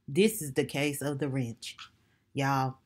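deep mock-serious case intro. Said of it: "I gotta put my deep voice on".

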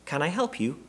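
high little bear voice, questioning; inquiring. A narrator's reading. Bear offering the Browns help, inquiring. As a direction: ask politely in a high, small voice. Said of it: "looked at them inquiringly".

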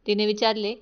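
usual speaking voice

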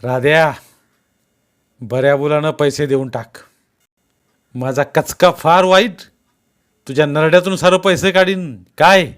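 loud menace, climbing in pitch; roaring threat. A narrator's reading. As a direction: roar a warning, building to a sharp challenge. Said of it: "पाहून गराजला".

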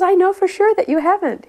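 high, loud imitation of a rebuke; retold stern contradiction. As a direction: contradict him forcefully, as the guru. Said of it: "getting heavier and heavier with him".

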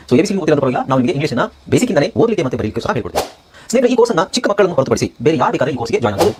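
energetic, forceful promo announcement; course ad read. Acted. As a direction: pitch the course to listeners. (ಜೋರಾದ ಜಾಹೀರಾತು ಧ್ವನಿ)

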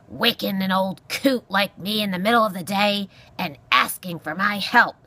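loud, irascible porcupine voice; grumpy old porcupine. Acted. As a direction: scold as a crotchety old animal. Narrator voices the ranting porcupine.

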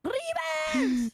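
high-pitched yelled call; game yell. Real, not acted. Player yelling to move her game character, high yell.

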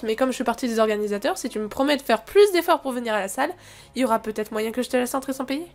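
normal talking voice

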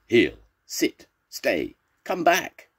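high, loud dog commands; acted shouts, pitched well up; storybook shouting. A narrator's reading. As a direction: call out to runaway dogs. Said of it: "shouted the dog's people".

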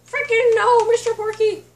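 loud, high cry of real dismay; dismayed shout. Real, not acted. Kid shouting over his dead dog, real dismay. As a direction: yell it out as the pet is gone.

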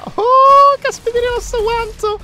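high amazed exclamation. Real, not acted. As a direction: gasp in amazement. Amazed at the beast.

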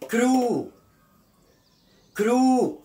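كرو with a long, drawn-out final vowel. Shown as a demonstration. Teacher models the first example for learners, long vowel. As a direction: stretch the vowel at the end of كرو. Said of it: "كأو طويلة".

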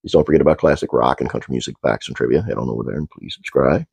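ordinary delivery

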